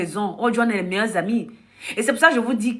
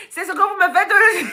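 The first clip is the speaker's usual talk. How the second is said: laughing mock outrage; 8 semitones higher, 3 dB louder than usual.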